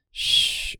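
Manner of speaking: breathy hush to soothe her; whispered shush. Acted. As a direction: shush her gently like a frightened child. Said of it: "Owena whispers".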